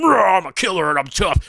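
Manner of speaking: high, sneering tough-guy imitation; mock a blustering soldier. Mocking a veteran's tough-guy act, sneering. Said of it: "all this shit about".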